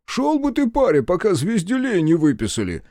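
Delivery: high-pitched, jeering dismissal; mocking scoff. A narrator's reading. Fat policeman brushing off a demand for papers, mocking. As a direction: scoff from up high, chuckling at the upstart. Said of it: "хохотнул Мордатый".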